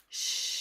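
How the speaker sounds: steady hushing exhale; long shh. Shown as a demonstration. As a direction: let the air out on a long shh. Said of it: "go out in that shh".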